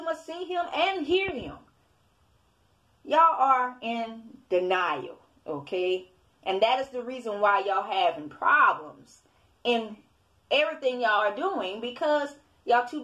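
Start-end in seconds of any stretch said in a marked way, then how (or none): none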